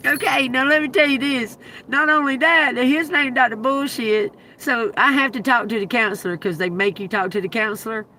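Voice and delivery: ordinary talk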